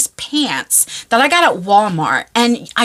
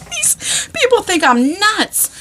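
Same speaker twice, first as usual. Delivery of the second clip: laughing; 8 semitones higher, slower than usual. Real, not acted. Laughing at herself.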